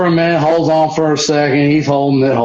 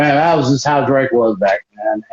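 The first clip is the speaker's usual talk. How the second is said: ordinary talk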